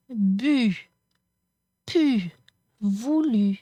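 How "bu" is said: ordinary talk, nothing marked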